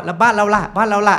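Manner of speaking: loud, high-pitched needling question; sarcastic jab. Shown as a demonstration. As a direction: throw it out as a pointed satirical dig. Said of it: "คอยแซะ แบบ เปรียบเทียบเสียดสีอะ".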